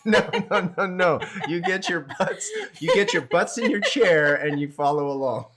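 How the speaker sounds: loud, high playful telling-off; mock scolding. Instructor to popcorn-eating viewers, mock bossy.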